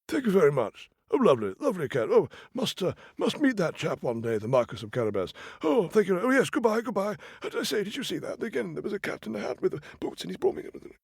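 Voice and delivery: high royal voice fading to a hushed mutter; delighted king. Acted. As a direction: thank warmly, then marvel under your breath. King thanks, then marvels.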